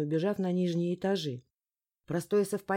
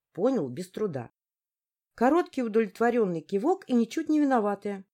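usual speaking voice

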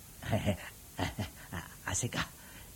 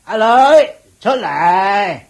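loud, higher call across the house; 6 semitones higher, 5 dB louder than usual. Acted. Mistress calling her maid out to meet the young lady, loud call. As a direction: summon the maid from the back room.

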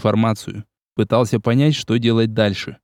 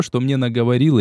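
ordinary delivery

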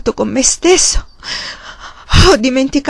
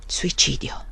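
soft, whispered, hollow answer; empty, drained whisper; 8 semitones lower, 12 dB quieter, slower than usual. A narrator's reading. Magnus confirms the suicide to the prior, hollow whisper. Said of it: "rispose Magnus con voce vuota".

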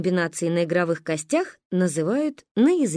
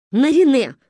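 loud, higher angry snap; angry shout; 6 semitones higher, 7 dB louder than usual. Acted. Mother scolds her giggling daughter, loud and angry. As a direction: bark her name to stop her. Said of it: "рассердилась мама".

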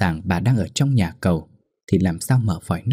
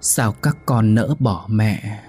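sorrowful ghost lament; 3 semitones higher, much slower than usual. Acted. Dead mother reproaching her children at the door, slow ghostly lament. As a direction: stretch every word like a lost soul. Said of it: "càng tha thiết của bà cụ Soan".

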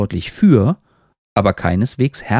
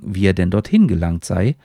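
usual speaking voice

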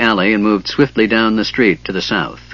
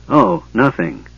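ordinary delivery, nothing marked

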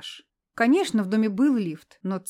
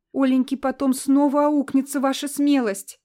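lamenting; 5 semitones higher, 5 dB louder than usual. Acted. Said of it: "запричитала Лидия Андреевна".

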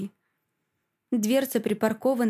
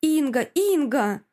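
loud, high repeated cries; panicked screaming; 5 semitones higher, 4 dB louder, slower than usual. A narrator's reading. Mother screams after the car taking her daughter, panicked. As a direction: yell the name in rising fear. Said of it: "заорала я вне себя от страха".

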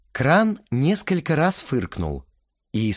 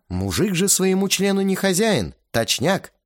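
ordinary talk, nothing marked

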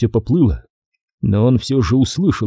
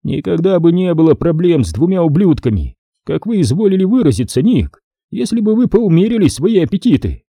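higher, mocking older major; a sly, indulgent Stone; Stone's silky voice; 7 semitones higher than usual. A narrator's reading. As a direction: voice Stone teasing his partner. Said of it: "возразил Стоун".

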